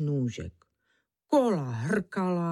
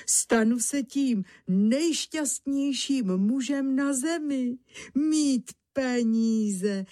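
elated daydreaming; 3 semitones higher than usual. Acted. Honza dreaming of money in his pocket, elated. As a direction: revel in the coming good fortune. Honza rejoices at promised riches.

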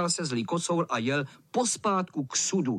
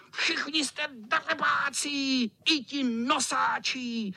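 high, drawn-out insults; high indignant cat rant; cursing tomcat; 9 semitones higher, slower than usual. Acted. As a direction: squeal abuse slowly at everyone. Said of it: "který zas už nadával".

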